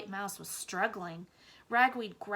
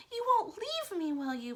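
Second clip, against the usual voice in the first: high, louder pleading; a small scared mouse begging; frightened plea; 12 semitones higher, 4 dB louder than usual. A narrator's reading. Blinker begging Ragweed not to leave, high and scared. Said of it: "Blinker cried".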